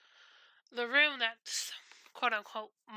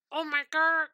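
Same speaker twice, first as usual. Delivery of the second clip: delighted squeal; 4 semitones higher than usual. Retold or imitated. Friend shows a pink heart nightlight, gushing delight. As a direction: gasp and gush at the cutest thing. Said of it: "My brain goes".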